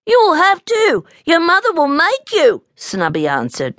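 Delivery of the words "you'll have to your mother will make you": put-on very high, insistent child voice; squeaky cub contradicting a friend; arguing little bear. A narrator's reading. Little bear arguing back in story.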